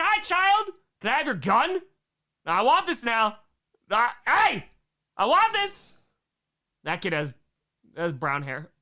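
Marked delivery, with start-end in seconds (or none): 0.0-6.0 put-on high, insistent kid's demand; voiced small child. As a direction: demand it like a pushy little kid. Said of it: "It's like".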